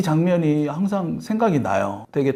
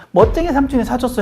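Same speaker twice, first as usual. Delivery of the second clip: put-on high, proud little-girl voice; bragging child; 9 semitones higher, 4 dB louder than usual. Child brags to grandparents about the dress, high and proud. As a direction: show off like a first grader. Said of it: "막 자랑하면서".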